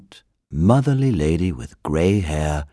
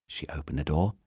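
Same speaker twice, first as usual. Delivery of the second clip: ordinary delivery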